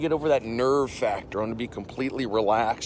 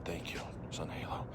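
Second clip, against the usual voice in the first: whispered thanks; 7 semitones lower, 16 dB quieter than usual. Real, not acted.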